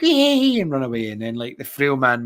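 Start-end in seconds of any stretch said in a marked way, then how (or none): 0.0-0.6 mock cheer. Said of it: "then you're like".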